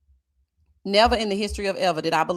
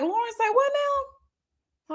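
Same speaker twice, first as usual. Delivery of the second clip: high, slow, drawn-out incredulous question; mock disbelief; 13 semitones higher, much slower than usual. Reacting to Lauren's comment in mock disbelief. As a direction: stretch it out in disbelief. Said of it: "And I was like".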